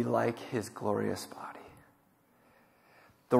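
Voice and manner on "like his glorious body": whisper. Acted. Scripture reading fades to hush.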